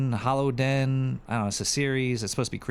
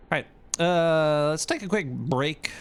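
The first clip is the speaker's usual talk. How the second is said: host voice; 7 semitones higher, 4 dB louder than usual. Acted. Radio host break.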